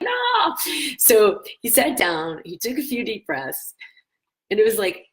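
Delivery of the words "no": high, mock-frustrated yelp of no. Frustrated husband refusing the advice, high mock yelp. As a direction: fling out a childish no. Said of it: "you're so frustrated that you're like".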